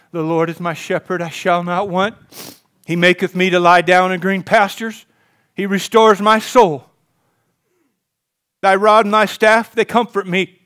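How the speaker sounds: loud, fervent psalm recital; psalm recitation. Acted. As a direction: proclaim the psalm with conviction.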